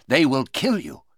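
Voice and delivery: ordinary delivery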